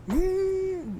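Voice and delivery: very high-pitched vroom; imitated engine rev. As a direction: squeal out a revving car noise. Imitates car speeding off.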